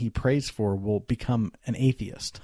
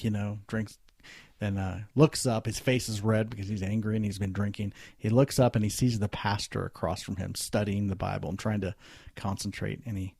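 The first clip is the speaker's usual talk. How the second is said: ordinary talk, nothing marked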